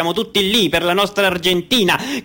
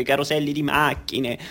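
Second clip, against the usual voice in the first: usual speaking voice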